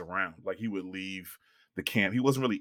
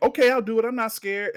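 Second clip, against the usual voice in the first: mock brave girl; 12 semitones higher, 5 dB louder than usual. Girl accepting Candyman dare.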